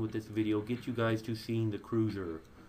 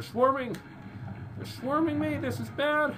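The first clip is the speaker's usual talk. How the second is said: alarmed. Real, not acted. Enemies swarming his fleet.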